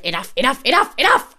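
repeated command, climbing to high and loud; stopping everyone. Retold or imitated. Amanda stopping the whole table, rising loud command. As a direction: build an imitated cry for order. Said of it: "stopping everyone with a kind of".

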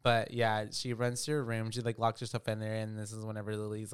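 normal talking voice

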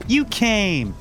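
high delighted shout; excited shout. Acted. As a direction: greet him with a happy cry. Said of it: "she shouted".

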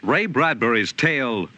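ordinary talk, nothing marked

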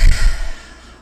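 a long, loud audible sigh out; deep exhale. Real, not acted. Winding down the episode, she lets out a deep exhale. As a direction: let out a big deep exhale to release. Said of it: "deep exhale".

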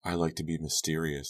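ordinary delivery, nothing marked

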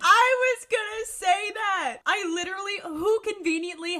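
high, loud burst of agreement; excited agreement. Real, not acted. Co-host seizes on the same complaint, excited shout. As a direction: jump in with a real excited shout. Jumps in agreeing about the bow.

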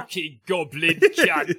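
drawn-out cockney swearing, lightly voiced; slow cockney curse. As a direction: lean into a cockney growl. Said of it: "That's what he's gonna sound like".

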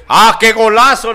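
excited goal call, loud and high; breakneck goal commentary. Retold or imitated. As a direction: shout it like a TV narrator. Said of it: "Nomás dijo el vato que estaba narrando".

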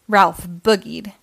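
ordinary delivery

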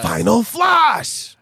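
slow, huge shouted attack name; anime attack shout. Pretend. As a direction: bellow it like an anime hero.

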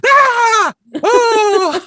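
very high, loud shocked scream; imitated shriek. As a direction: shriek like a horrified mom. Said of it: "Just, like, shrieking".

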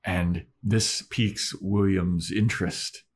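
usual speaking voice

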